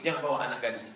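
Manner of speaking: ordinary talk, nothing marked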